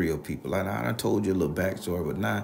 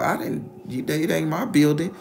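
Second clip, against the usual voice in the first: incredulous; 8 semitones higher, 4 dB louder than usual. Retold or imitated. Incredulous reply to apology.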